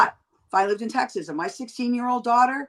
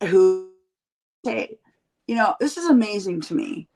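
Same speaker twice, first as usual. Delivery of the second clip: ordinary talk, nothing marked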